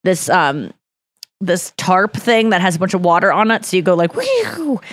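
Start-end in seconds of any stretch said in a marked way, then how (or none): 4.2-4.8 high, breathy whew, softer than her talk; skid whoosh effect. Retold or imitated. Describing skidding over wet tarp, doing a soft high whew. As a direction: blow out a sliding-car whoosh. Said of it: "cool sound effects".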